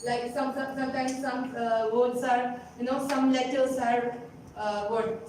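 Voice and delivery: usual speaking voice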